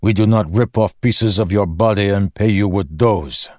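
put-on a big Horneater growling in his thick accent. Rock growls his threat at the den keeper, in a heavy accent. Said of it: "an accented voice growled".

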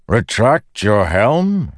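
high, slow, incredulous call; shouted question. A narrator's reading. Windrunner questioning his companion's odd complaint, shouting. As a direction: shout it back as a drawn-out question. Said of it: "he shouted".

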